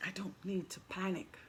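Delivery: soft whisper to herself; whispered affirmation. Real, not acted. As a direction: breathe it out like a private mantra.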